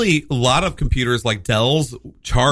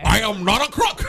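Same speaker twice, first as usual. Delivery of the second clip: Nixon impression; 6 semitones higher than usual. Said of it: "like Richard Nixon".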